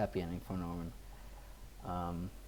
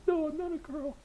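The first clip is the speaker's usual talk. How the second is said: put-on much higher, pleading boy's voice; protesting boy. Son protesting to his mother, high pleading voice. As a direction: whine it as the hurt young son. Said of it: "He's like".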